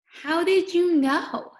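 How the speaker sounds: high, loud and slow, amazed; mock amazed teacher; delighted surprise. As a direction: sound thrilled they found it. Teacher praising child's guess.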